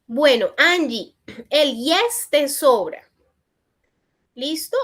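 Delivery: normal talking voice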